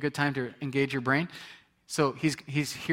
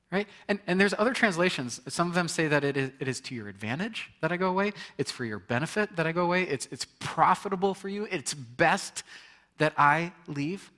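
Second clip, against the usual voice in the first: ordinary talk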